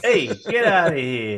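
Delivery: put-on slow Italian-American street accent; drawn-out mock Italian-American accent. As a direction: dismiss them like an Italian-American tough guy. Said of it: "Italian-American".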